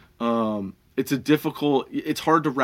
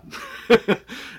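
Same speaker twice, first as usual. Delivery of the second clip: laughter; 10 semitones higher than usual. Real, not acted. Laughing at the shop's reaction.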